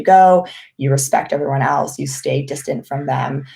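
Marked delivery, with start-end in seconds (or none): none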